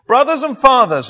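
loud, high-pitched call to an assembly; addressing a crowd. Acted. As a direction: raise the voice to address a hostile crowd. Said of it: "he says to this assembled crowd".

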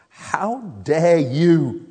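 slow, weighty "How dare you?" with stress on "dare"; God's rebuke. Retold or imitated. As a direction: let each word land slowly, a mild stern rebuke. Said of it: "How he looks at me and says".

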